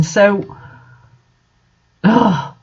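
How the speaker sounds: ordinary delivery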